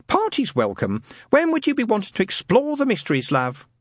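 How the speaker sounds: high, motherly Cockney-ish lady voice; chatty landlady. Acted. Madam Tracy answering Newton's call, homely and high.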